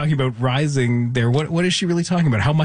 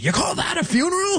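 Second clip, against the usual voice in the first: imitated yell. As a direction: cry it out high in mock outrage. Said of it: "we heard somebody yell out".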